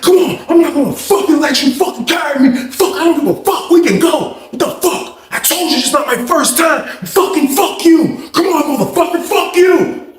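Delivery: squaring up; mock fight challenge.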